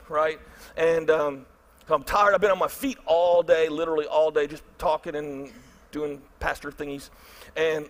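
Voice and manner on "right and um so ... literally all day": ordinary talk, nothing marked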